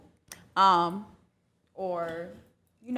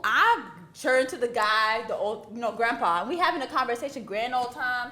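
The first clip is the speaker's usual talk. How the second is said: ordinary talk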